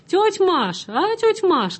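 high, loud call down the carriage; girl calling to aunt Masha at the far end. A narrator's reading. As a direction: call to her aunt across the wagon. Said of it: "крикнула одна из девушек".

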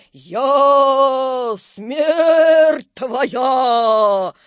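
extremely slow, loud, wailing doom; terrible howl. A narrator's reading. Ghost reveals itself as Death, terrible drawn-out howl. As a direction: stretch every word into a long howl. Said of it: "ужасным воем".

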